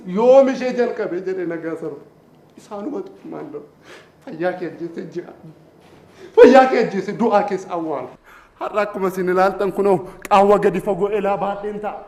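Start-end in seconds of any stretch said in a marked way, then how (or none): 4.3-5.3 low, soft poetic line; hushed recitation. Acted. Poet starting a repeated line, hushed. 6.4-8.1 strong attack on the first word, then falling; forceful recitation. Acted. Poet repeating the line, forcefully declaimed.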